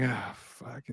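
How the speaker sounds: soft, low, resigned curse; muttered defeat. Retold or imitated. Fan turning off a losing game, soft resigned curse. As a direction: give up under your breath. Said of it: "I was like".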